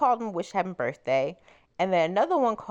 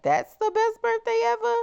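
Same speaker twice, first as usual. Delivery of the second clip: much higher, loud disbelieving question; incredulous; 3 dB louder than usual. Retold or imitated. Thinking about his sad birthday text, high incredulous squeak. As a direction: ask it in shocked disbelief, pitch flying up. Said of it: "in my head, I'm like".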